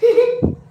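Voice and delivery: loud, high laugh bursting out; burst of laughter. Real, not acted. (ज़ोर की ऊंची हंसी)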